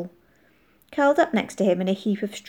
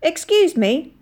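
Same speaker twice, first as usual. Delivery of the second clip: higher, louder polite call; calling out politely; 9 semitones higher, 5 dB louder, slower than usual. A narrator's reading. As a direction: politely hail someone from up in a loft. Unseen stranger calls from the loft.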